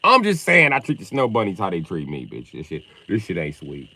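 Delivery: usual speaking voice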